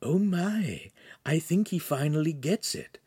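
high, airy stage whisper. A narrator's reading. Pan to Handsome about Lincoln finally getting it, whispered. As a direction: whisper it in amazement. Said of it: "Pan whispered to Handsome".

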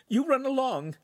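high choked goodbye; tearful mother AI. Acted. Charlene sending her son off, teary.

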